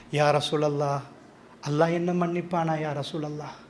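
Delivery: low, hushed imitation of a remorseful plea; retold tearful plea. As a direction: plead very softly for forgiveness. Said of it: "எதுக்கு அழுதார் தெரியுமா?". (தாழ்ந்த குரலில் மன்றாடுதல்)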